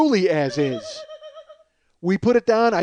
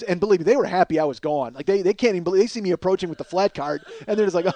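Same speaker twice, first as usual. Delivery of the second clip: ordinary talk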